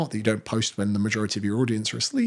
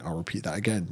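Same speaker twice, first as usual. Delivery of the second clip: ordinary delivery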